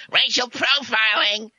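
high, drawn-out mock shriek; mock scream. Host mocks liberals screaming like rats and mice, mock scream. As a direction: scream it slowly like a panicking protester. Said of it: "you'll be the first to scream".

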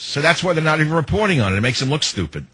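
normal talking voice

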